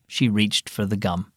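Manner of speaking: ordinary delivery, nothing marked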